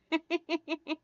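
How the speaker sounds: high little giggle; real tittering laugh; giggling. Real, not acted. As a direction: giggle in a quick light run. Amused by her own remark.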